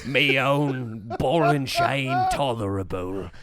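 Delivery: put-on old judge's slow declaration to his darling; amorous old judge. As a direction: draw it out like a bewigged old judge. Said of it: "he comes into the pub and he says".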